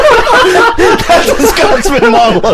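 laughing. Real, not acted. Laughing mock Scots.

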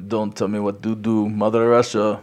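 mock Russian accent, hammed-up on request; deliberately bad Russian accent; awful Russian accent. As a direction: play a defiant Russian with a bad put-on accent. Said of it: "do an awful Russian accent".

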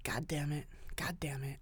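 hushed, breathy-free whispered curse. Retold or imitated. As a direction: curse under your breath.

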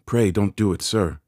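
high, loud frightened begging; pleading in terror. A narrator's reading. Boy begs the convict not to, high terrified plea. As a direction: plead urgently to be spared. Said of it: "I pleaded in terror".

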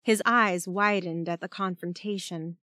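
ordinary delivery

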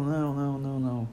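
ordinary talk, nothing marked

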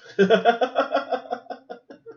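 long, high-pitched run of giggles; real laugh. Real, not acted. Viewer amused by own thumb-sucking joke, high laughing.

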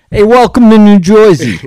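loud, high Jersey greeting; New Jersey accent. Pretend. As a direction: shout it like a Jersey tough guy.

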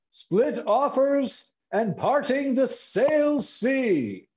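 high, very slow epic title announcement; grand aristocratic gusto. Acted. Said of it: "feels good to do it that way".

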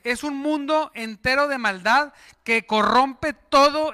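solemn preacher's reading; scripture reading. Acted. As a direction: read the verse with weight. Reads scripture.

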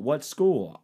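ordinary delivery

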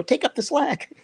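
high, strained call over rushing water; retold panicked order, pitched far up; urgent shout. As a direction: yell across the waterfall to the rope holder. Repeating order to stunt guy.

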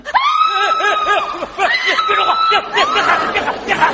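very high-pitched frightened stammer; shrieky startled pleading; panicked. Acted. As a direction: squeal in fright, stumbling over repeats. Startled in dark by stranger.

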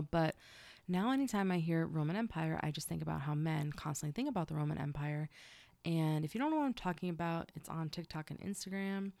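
ordinary talk

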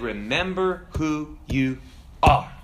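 slow, solemn proclamation with long pauses; Mufasa. Acted. Ghost father naming his son the true king, stately and commanding. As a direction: declare it word by word, swelling in the middle. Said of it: "he continues and said".

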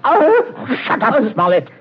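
high, exasperated order; irritated command. Acted. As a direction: cut him off impatiently. Gunman silences protesting captive.

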